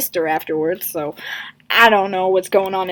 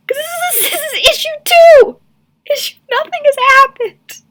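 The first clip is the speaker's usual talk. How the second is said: high, loud, cracking laugh-yell; laughing rant; 14 semitones higher, 6 dB louder than usual. Real, not acted. Ranting to viewers about the comic, wheezing with laughter. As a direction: rant while breaking up laughing.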